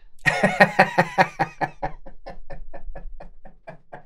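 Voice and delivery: long laugh at the punchline; drawn-out breathless laughter. Real, not acted. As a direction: burst out laughing and let it run on. Laughs at her own punchline.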